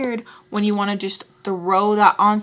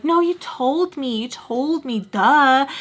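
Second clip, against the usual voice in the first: slow, higher-pitched mimicked contradiction; mock insistent; 4 semitones higher, much slower than usual. Friend Nar correcting her over who told whom, mock insistent. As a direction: drag it out like an obvious correction.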